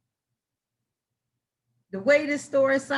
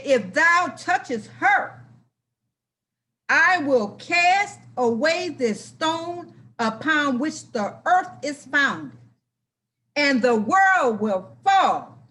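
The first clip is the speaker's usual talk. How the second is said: slow, loud, higher divine warning; voice of the Most High; 5 semitones higher, 6 dB louder, slower than usual. Acted. The Most High warns the boy, slow and loud. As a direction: boom it out slowly like God's own threat. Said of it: "The Most High said".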